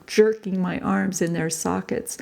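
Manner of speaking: plain reading voice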